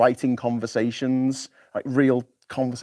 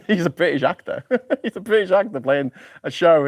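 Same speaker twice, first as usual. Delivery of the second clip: chuckling through the words, pitch up; amused that a Welshman plays the American; laughing; 5 semitones higher than usual. Real, not acted. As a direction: laugh it out in disbelief.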